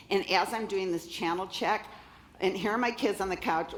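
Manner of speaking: ordinary talk, nothing marked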